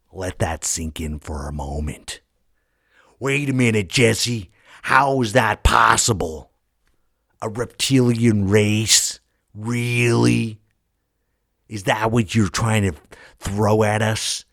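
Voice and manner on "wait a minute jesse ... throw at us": hammed-up shocked skeptic; mock disbelief. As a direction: play the doubter who can't believe it. Voicing a doubting listener.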